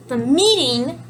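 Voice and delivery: "meeting" leaned on and left hanging upward; stress on meeting. Shown as a demonstration. Teacher marking the topic for learners, "meeting" stressed. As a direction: set "meeting" apart as the topic. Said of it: "emphasizing the meeting".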